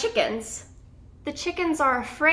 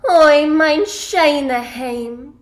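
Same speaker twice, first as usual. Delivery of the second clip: slow, drawn-out wistful cry easing off; storyteller's longing reminiscence; reminiscing; 6 dB louder, slower than usual. A narrator's reading. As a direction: sigh over a remembered home, stretching the opening. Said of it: "Yetta reminisces".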